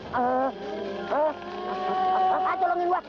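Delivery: high panicked yelps for help; panicked cries. Acted. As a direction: cry out in panic, voice climbing high. Exorcist attacked, crying for help.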